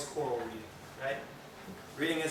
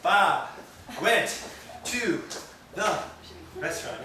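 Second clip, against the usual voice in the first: jumping-jack reading; 2 semitones higher, 3 dB louder, slower than usual. Shown as a demonstration. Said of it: "Jumping jacks".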